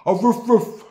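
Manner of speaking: dog arguing back with the Jamaican man; dog talking back. Retold or imitated. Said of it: "looked at the man and said".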